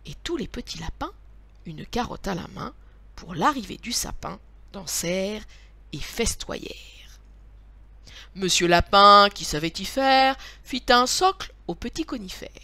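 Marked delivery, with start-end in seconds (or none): none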